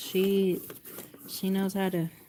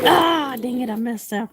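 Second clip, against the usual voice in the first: high, loud cry easing into annoyance; yelp, then grumble at yourself; frustrated yelp; 6 semitones higher, 7 dB louder than usual. Real, not acted. Quilter to herself after a stitching slip, frustrated. Makes a sewing mistake.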